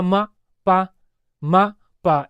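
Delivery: "मा, पा" as separate single syllables; baby repeating syllables. Shown as a demonstration. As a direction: lightly imitate a baby echoing "मा, पा". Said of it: "इस तरह से वह बोल देगा".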